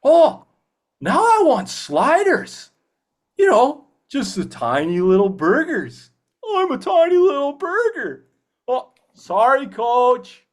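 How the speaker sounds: very high, loud, slow cartoon voice; pure positivity, squeaky and bright-eyed; cheeky [name]. Actor slips into his positive cartoon character, very high and loud. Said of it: "getting into the mindset".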